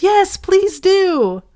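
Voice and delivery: high, slow drawn-out plea; chorus pleading. Acted. The others begging the mouse in a high, slow chorus. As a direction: stretch it out like a crowd begging. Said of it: "joined in chorus".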